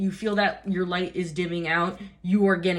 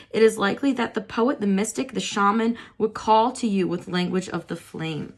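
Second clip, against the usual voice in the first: measured, slightly raised reading voice; reading aloud; 4 semitones higher than usual. Acted. Reading the card's guidebook text to viewers, measured reading voice. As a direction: read the guidebook passage evenly.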